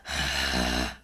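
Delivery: low rumbling throat growl. Shown as a demonstration. Teacher demonstrating the growl sound. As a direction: growl deep at the bottom. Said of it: "All you wanna do is growl".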